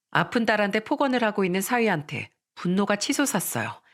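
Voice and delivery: usual speaking voice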